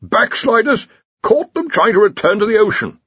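high, loud, outraged accusation; enraged. Acted. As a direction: denounce them, beside yourself with rage. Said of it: "beside himself with rage".

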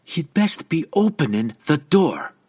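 high, firm threat; even and dangerous. A narrator's reading. Young woman threatening the lady to open the door, dangerous. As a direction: warn with controlled menace. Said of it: "her tone even and dangerous".

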